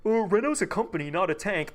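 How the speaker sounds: mocking imitation. Streamer parroting a chat member's nitpick, mocking.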